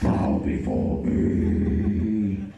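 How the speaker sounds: deep, slow demonic boom; booming demon lord. Pretend. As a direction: announce yourself as a dark god. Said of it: "You, you must be a demon".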